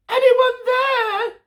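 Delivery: very high, slow call. Acted. Olive calling out for anyone. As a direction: call out into the dark. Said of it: "she called out".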